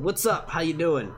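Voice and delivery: usual speaking voice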